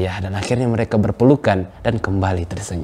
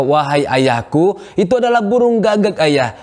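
exasperated, pitched higher still; son, asked yet again, snaps back higher; more irritated; 7 semitones higher than usual. Acted. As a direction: push the irritation up another step. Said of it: "dengan intonasi yang lebih tinggi lagi".

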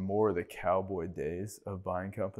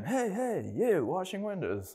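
much higher, insistent hailing call; stranger calling out; 11 semitones higher, slower than usual. Retold or imitated. Driver honking and hailing the window washer, high insistent call. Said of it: "And the guy, like, honks again".

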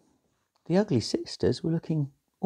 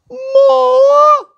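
high, loud crying shriek; shrieking demand; 10 dB louder than usual. A narrator's reading. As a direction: screech it out greedily. Sisters demanding more ripping.

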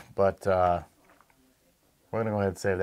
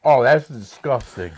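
disgusted by taste; 3 semitones higher, 7 dB louder, slower than usual. Real, not acted. Reacting to salted egg taste.

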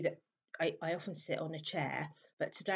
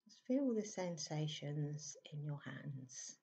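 soft, unhurried meditation voice with long pauses; hushed, calm meditation guidance; guided meditation; 6 dB quieter than usual. Acted. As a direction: gently guide listeners into stillness, leaving space between phrases. Leads a meditation.

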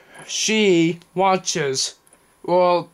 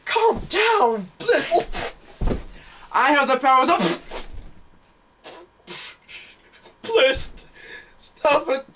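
pleading; 5 semitones higher, slower than usual. Pretend. Pretend Force torture, pleading.

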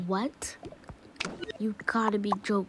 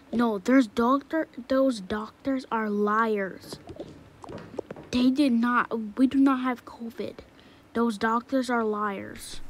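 loud, slow furious accusation; angry denial; 6 dB louder, slower than usual. Acted. Child angrily calling the doctors liars. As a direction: stomp out each word in defiance.

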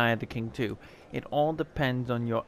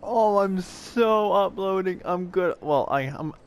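giddy; 5 semitones higher, 4 dB louder than usual. Real, not acted. Calming down after the win.

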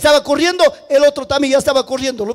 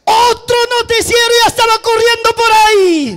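high, loud storyteller's alarm; excited cry; 9 semitones higher, 4 dB louder than usual. A narrator's reading. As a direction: cry out that someone is coming. Preacher announces another running messenger. (grito agudo y exaltado)

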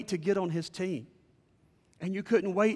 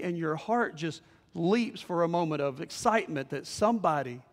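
usual speaking voice

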